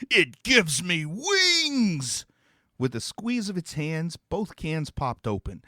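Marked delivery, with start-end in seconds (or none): 0.1-2.3 slow, higher, booming demon cry; bellowed. Acted. Demon bellowing after its payment. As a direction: roar it out in triumph. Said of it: "It bellowed".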